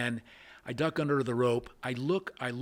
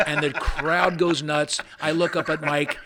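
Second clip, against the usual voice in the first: louder, slowed, laughing all the way through; 3 semitones higher, 8 dB louder, slower than usual. Real, not acted. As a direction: laugh out the big moment. Retelling the hole in one.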